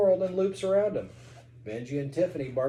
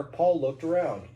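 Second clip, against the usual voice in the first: ordinary delivery, nothing marked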